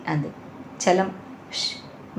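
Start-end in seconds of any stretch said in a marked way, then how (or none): 1.5-2.2 a sharp whispered shush; worried brother whispering a warning shush; whispered hush. A narrator's reading. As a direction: hush them anxiously under the breath. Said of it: "ఆదుర్దాగా హెచ్చరిస్తున్నాడు".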